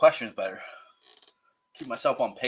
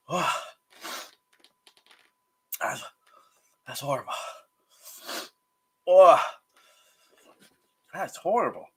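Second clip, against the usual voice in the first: breathless suffering. Real, not acted. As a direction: whisper it through a burning mouth. Suffering through hot sauce burn.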